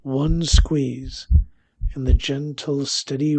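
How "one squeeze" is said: usual speaking voice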